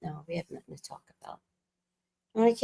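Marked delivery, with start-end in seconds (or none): 0.0-1.4 hushed, imitating dad's quiet refusal; very soft mock-dad murmur. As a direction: shut it down in a tiny, soft voice. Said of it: "very soft".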